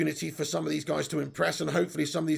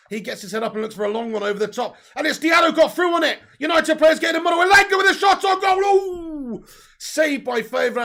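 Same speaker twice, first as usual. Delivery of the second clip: rising, louder and higher commentary as the shot comes in; live play-by-play; 10 semitones higher, 9 dB louder than usual. Acted. Fan commentating a United chance on stream, excited play-by-play. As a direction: call the attack building to a shout.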